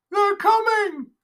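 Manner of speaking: high, loud, slow drawn-out panicked cry; stretching the wail out in terror. Acted.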